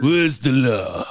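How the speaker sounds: pompous slow voice. Acted.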